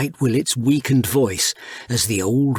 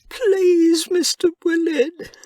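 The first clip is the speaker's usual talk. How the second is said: much higher, anguished mouse voice; a little mouse distraught over his dying friend; young mouse Pip; slower than usual. A narrator's reading. Pip begging.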